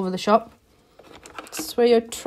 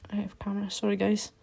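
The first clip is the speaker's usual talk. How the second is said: whispered apology; 11 dB quieter, much faster than usual. Real, not acted. Whispering an apology to viewers after a camera mishap. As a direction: breathe the apology, embarrassed.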